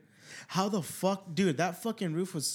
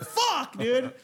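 mock dismay; 8 semitones higher, 4 dB louder than usual. Retold dismay, no money.

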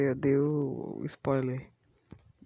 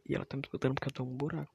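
normal talking voice

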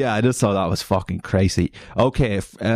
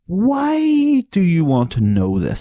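mock incredulous; 8 semitones higher, slower than usual. Mock outrage at an absurd question.

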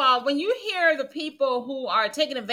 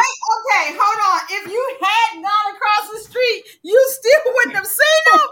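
excited; 8 semitones higher, 5 dB louder than usual. Real, not acted. Cutting in, incredulous.

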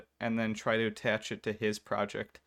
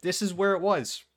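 exasperated insistence; 8 semitones higher, 5 dB louder than usual. Retold or imitated. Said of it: "I'm just like".

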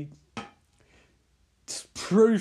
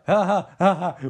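a hammed-up stoned cackle, louder; mock laughter; 7 dB louder than usual. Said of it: "They're like".

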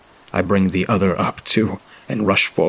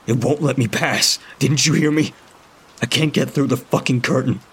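high, exasperated protest; frustrated outburst; 7 semitones higher than usual. A narrator's reading. Narrator snapping at Toggs, frustrated. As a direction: argue back in frustration.

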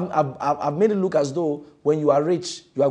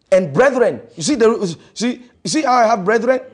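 fiery preaching; 8 semitones higher, 11 dB louder than usual. Acted. Preaching at full force.

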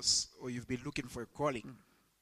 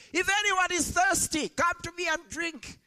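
loud shout; 5 dB louder than usual. Retold or imitated. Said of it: "in a loud voice shouts".

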